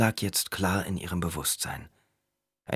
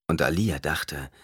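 plain reading voice

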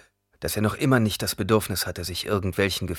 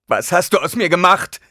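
high, loud demand; distraught, shouted question; accusing outburst; 10 semitones higher, 10 dB louder than usual. A narrator's reading. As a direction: confront her at full voice. Son accuses his mother.

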